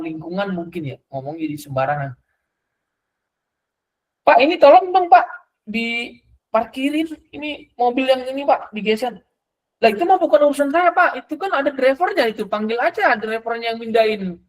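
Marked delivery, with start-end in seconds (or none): 4.2-9.1 high, loud call asking for help; a customer calling out to the parking attendant, much higher; pleading customer. Retold or imitated. Customer asks attendant to move car. 9.8-14.4 high, offhand brush-off; parking attendant brushing off a customer, high and offhand; dismissive attendant. Retold or imitated.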